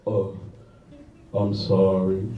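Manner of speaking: low, soft little apology; a lightly voiced sheepish guy; mock meek apology. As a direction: mumble it sheepishly like him. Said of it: "I'll never forget his voice".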